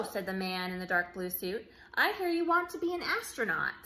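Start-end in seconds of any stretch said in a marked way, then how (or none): 1.9-3.7 higher, a touch brighter man's voice; lightly voiced official. Official in blue suit to the boy, light voiced. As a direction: lightly play the space official.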